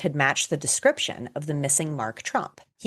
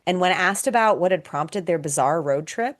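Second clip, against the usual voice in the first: ordinary talk, nothing marked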